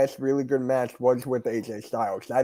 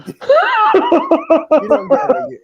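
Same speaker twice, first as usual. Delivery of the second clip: laughing hard, high and loud, words stretched out; 10 dB louder, much slower than usual. Real, not acted. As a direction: squeal it through a big laugh.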